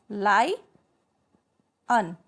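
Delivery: लाई, long pause, then अन; syllable split. Shown as a demonstration. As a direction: break the word into लाई and अन. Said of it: "अन का sound दे रहा है". (लाई और अन को अलग-अलग बोलें)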